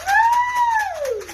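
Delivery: squealing woo; high excited whoop. Real, not acted. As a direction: shriek it with glee. Cheer after shaking.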